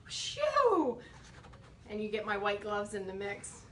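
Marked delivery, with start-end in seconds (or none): 0.1-1.0 high relieved whoop; relieved shout. Real, not acted. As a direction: let it burst out high and loud. Lid finally opens.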